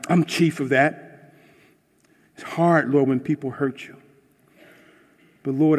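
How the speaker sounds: pleading prayer that swells then fades; earnest prayer. Acted. Pastor praying aloud for his hearers, earnest and pleading. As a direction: pray over the listeners.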